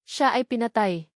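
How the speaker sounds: ordinary delivery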